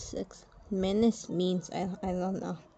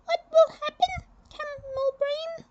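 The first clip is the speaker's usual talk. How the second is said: a much higher, firm general announcing the burial; stern general voice. Acted. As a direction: speak as a proud Egyptian general, high and set. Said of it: "he asked".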